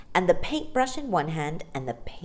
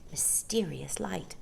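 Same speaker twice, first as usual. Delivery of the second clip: ordinary delivery, nothing marked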